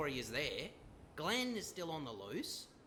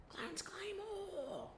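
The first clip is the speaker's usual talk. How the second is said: high, drawn-out soft cheer of a name; hushed battle cry; 3 dB quieter, much slower than usual. Acted. Coach hyping his trainee's name, hushed mock battle cry.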